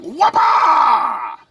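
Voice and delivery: high, drawn-out mock martial-arts cry; kung fu yell. Shown as a demonstration. Demonstrating the bug smasher on flies, kung fu yell. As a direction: let out a ninja strike shout.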